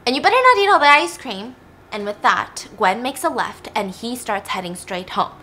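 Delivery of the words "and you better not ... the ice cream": louder, higher sibling warning; lightly acted bossy sister voice. Sister warns brother off the ice cream, mock bossy.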